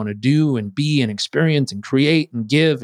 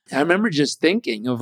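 ordinary talk

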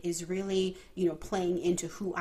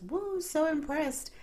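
high, slow mock awe, woo; mock amazement; 10 semitones higher, slower than usual. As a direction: play the wowed guests, stretched high. Guests marvel at baby's big words.

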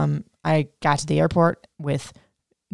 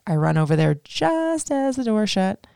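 ordinary talk, nothing marked

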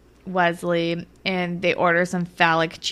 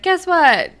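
eager tease; 10 semitones higher, 5 dB louder than usual. Retold or imitated. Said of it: "he's like".

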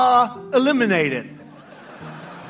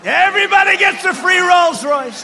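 much higher, loud sarcastic bellow; shouted sarcastic joke; 9 semitones higher, 7 dB louder than usual. Retold or imitated. Rally speaker yells his own joke to the crowd, hammed-up shout. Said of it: "I say sometimes jokingly, sarcastically".

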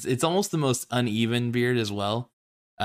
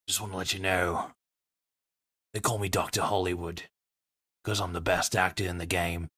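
a hint of a gruff movie tough guy; Jason Statham voice; 2 semitones lower than usual. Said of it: "I'm gonna do my best Jason Statham voice".

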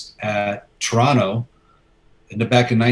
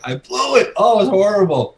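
much higher, wailing mock despair; mock anguish; 10 semitones higher, 4 dB louder than usual. Fan telling friend Joe he blew it, mock anguished wail. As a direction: wail it like a hammed-up disaster.

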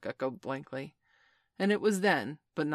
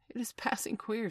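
higher-pitched, puzzled remark; uneasy; 7 semitones higher than usual. A narrator's reading. Smee puzzled among uncomfortable pirates, uneasy. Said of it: "they all fidgeted uncomfortably".

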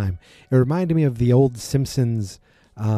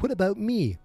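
whiny mock; 10 semitones higher, 4 dB quieter than usual. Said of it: "he's like".

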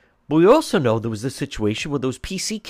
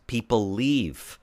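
slow emphatic statement; 2 semitones lower, 3 dB quieter, much slower than usual. Real, not acted. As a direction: let "People leave." sink slowly, as a final verdict. Host repeats point for emphasis.

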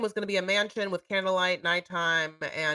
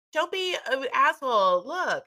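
high-pitched exasperated retelling; mock pleading; 6 semitones higher, slower than usual. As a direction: act out a friend insisting, pitched up. Acts out friend's playful quarrel.

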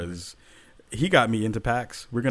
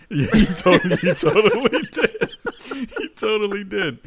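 laughing; 9 semitones higher, 8 dB louder than usual. Real, not acted. Laughing at a bad joke.

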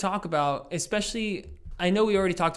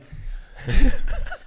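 high burst of laughter; 7 dB louder than usual. Real, not acted. Laughs at taped friend.

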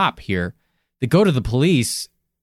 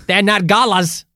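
put-on Aussie voice, hammed-up; mock Australian accent. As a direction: lay on a cheesy Australian accent. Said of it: "we know you love this authentic Australian accent".

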